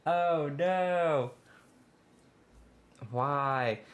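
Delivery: high, loud despairing oh no; despairing cry. Real, not acted. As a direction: moan at the lost progress. Realising progress is lost.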